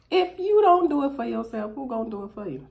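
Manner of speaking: loud, high preachy emphasis; preaching. Real, not acted. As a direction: preach it to the congregation. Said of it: "Preach it".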